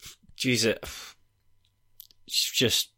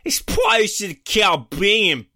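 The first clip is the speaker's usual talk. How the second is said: a bad American accent, hammed up and slow; 5 semitones higher than usual. Ranking read to his co-host in a bad American accent. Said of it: "So I'll just do my American accent then".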